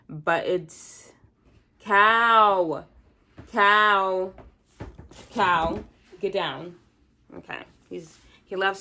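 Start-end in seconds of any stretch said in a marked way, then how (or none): 1.8-6.8 loud scolding call to the cat; louder call, fading on each repeat. Real, not acted. Calling the cat off chair.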